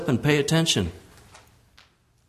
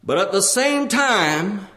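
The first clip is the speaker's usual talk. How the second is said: raised-pitch sermon delivery; high, insistent pulpit cry; impassioned preaching; 11 semitones higher than usual. Real, not acted. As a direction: preach it high, drawing out the key words. Preaching at full force.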